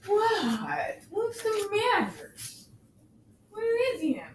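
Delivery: sweet high pet voice asking what's wrong; high cat-talk voice. Real, not acted. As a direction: coo up high to a startled kitten. Talking to her upset cat.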